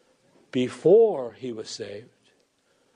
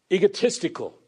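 usual speaking voice

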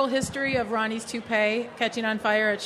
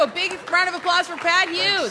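higher, enthusiastic announcement; rousing applause call; 7 semitones higher, 3 dB louder than usual. Real, not acted. Host asks audience to applaud the guest, rousing call. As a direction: whip up the crowd for the guest.

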